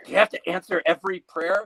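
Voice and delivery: high, put-on nagging voice; exasperated spouse. Partner quarrels with God over prayers, high and exasperated. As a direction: demand it in a high, fed-up voice.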